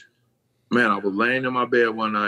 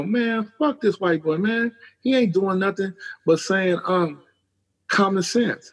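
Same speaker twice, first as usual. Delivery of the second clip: high, slow scoffing voice; high mocking dismissal; dismissive rant; 9 semitones higher, slower than usual. Dismissive rant about Gary Vee.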